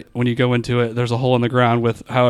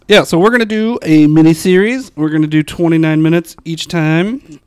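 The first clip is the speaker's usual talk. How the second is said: loud announcing; 4 semitones higher, 5 dB louder than usual. Real, not acted. Announcing podcast plan loudly.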